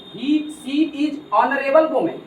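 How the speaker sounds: slow reading aloud. Shown as a demonstration. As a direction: spell out the question very slowly. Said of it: "यही question है". (धीरे-धीरे प्रश्न पढ़ना)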